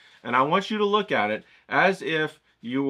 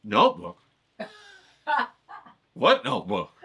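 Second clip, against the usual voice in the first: mock clueless viewer; 11 semitones higher than usual.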